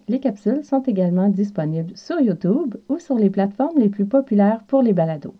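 ordinary delivery

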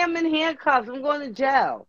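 hammed-up tearful protest; mock crying. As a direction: whimper it like a man caught. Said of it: "So I was crying in handcuffs".

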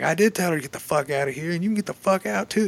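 a fed-up owner finally losing it; snapping back. Retold or imitated. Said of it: "Charles snaps back at him".

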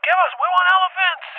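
high-pitched clamoring; imitated frantic demand. Kids wanting baby elephants, imitated high frantic demand. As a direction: mimic worked-up kids demanding. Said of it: "My kids are there losing their fucking minds".

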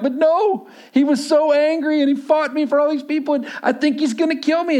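put-on frightened child pleading for dad to believe him; panicked kid.

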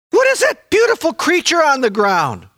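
high, hushed-to-crying-out amazement at first sight; a man seeing for the first time, overwhelmed with wonder; awed wonder. Acted. Preacher voicing the healed blind man, amazed wonder.

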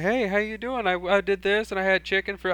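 put-on breezy kid filler before the big reveal; mock casual chatter. Kid making small talk with classmates, chirpy and high. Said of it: "And I'm like".

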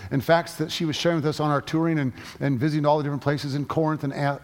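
normal talking voice

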